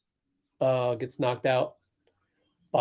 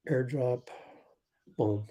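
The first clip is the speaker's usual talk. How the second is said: normal talking voice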